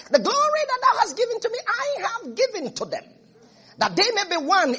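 lifted high, fast solemn prayer voice; Jesus praying. Acted. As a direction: voice Jesus lifting his prayer to the Father. Voicing Jesus's prayer in John.

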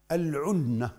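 العنة with a short u after the ع; damma on the ayn. Shown as a demonstration. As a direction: say العنة as al-ʿunna, starting "ʿu". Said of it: "بضم العين". (العنة بضم العين)

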